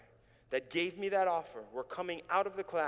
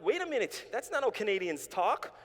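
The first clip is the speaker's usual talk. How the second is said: high, loud incredulous objection; raised, baffled voice; acted protest; 6 semitones higher, 5 dB louder than usual. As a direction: stop them short in mock disbelief. Acts objection to friends' new accent.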